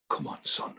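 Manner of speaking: breathy whispered urging from the dad; dad's whisper. Retold or imitated. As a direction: whisper it like a tense dad on speakerphone. Dad urging before the penalty.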